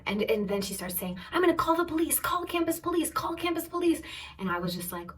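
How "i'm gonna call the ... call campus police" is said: very high, insistent retold threat; mock screaming. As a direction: imitate a hysterical mother yelling. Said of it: "her mom is screaming".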